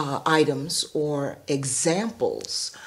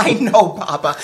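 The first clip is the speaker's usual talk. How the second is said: laughing reply; 3 semitones higher, 9 dB louder, faster than usual. Retold or imitated. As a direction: say it through a real laugh. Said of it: "And I laughed and laughed".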